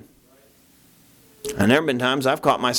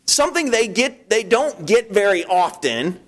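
high, exasperated inner rant; scolding himself, voice pitched way up; mock indignant; 7 semitones higher than usual. Preacher replaying his inner rebuke, high and exasperated. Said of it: "I'm like".

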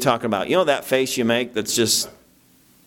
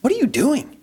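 high, shocked inner voice; a squeaky voice in his head scolding himself; mock inner voice; 13 semitones higher than usual. Preacher voicing his own shocked thoughts, high and incredulous. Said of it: "I went".

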